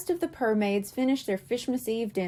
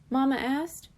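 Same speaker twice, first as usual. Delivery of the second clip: usual speaking voice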